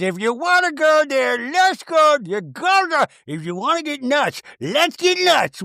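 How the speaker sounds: high, hammed-up combative diva; Meredith. Castmate daring Whitney to go there, mock-combative. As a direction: imitate a castmate's over-the-top challenge in a high put-on voice. Acted quarrel, Meredith's challenge.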